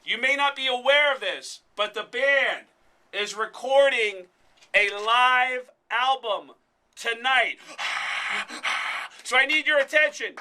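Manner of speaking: loud, high, projected stage announcement; arena announcer. Retold or imitated. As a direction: boom it out to a concert crowd, stretching key words. Re-enacts announcing to concert crowd.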